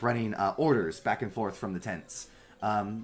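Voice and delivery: ordinary talk, nothing marked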